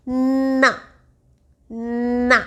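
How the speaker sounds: ना with the air through the nose, held clearly nasal; ना said slowly, its nasal hum clear; nasal na demo. Shown as a demonstration. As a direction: exaggerate the nasal ना for the child. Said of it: "एक में नाक से आवाज़ आ रही है न में".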